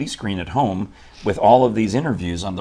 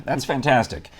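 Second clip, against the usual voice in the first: ordinary talk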